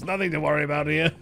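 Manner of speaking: ordinary talk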